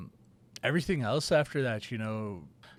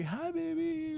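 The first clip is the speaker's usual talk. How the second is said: put-on very high, sing-song greeting to a baby; high-pitched baby talk; slower than usual. Mother greeting her baby in a high voice. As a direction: coo it up high like to an infant. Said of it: "my high-pitched voice when I, like, talking to my baby".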